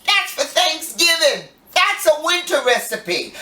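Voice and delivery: lightly voiced shocked fan; mock viewer. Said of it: "you're gonna say".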